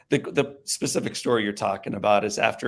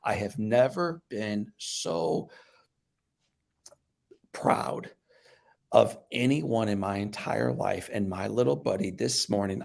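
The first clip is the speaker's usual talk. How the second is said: softer, slow, lower dad voice; tender proud dad; 3 semitones lower, 4 dB quieter, slower than usual. Retold or imitated. Dad at his burned son's bedside, slow and tender. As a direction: say it gently, full of pride. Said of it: "And he says".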